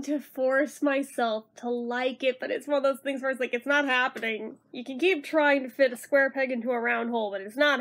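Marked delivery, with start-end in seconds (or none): none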